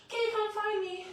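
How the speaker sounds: put-on high, loud little-kid call; hiding toddler. Toddler to seeker in hide and go seek, high playful call. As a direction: pipe up like a kid hiding in plain view.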